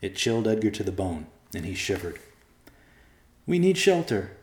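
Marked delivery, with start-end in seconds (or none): 3.5-4.3 put-on high-pitched boy character; young boy's voice. A narrator's reading. Boy tells his dog they need shelter, childlike.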